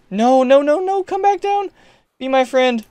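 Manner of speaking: ordinary talk, nothing marked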